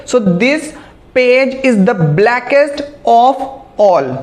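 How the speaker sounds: very slow, word by word example; carefully dictated English sentence; slow dictation. Shown as a demonstration. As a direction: read the example slowly for students.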